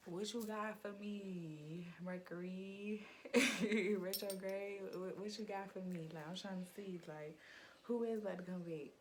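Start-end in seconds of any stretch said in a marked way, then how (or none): none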